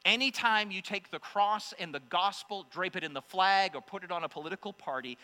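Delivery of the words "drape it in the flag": ordinary delivery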